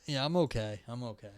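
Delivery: ordinary delivery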